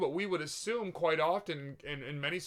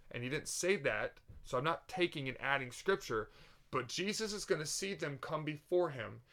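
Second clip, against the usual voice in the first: usual speaking voice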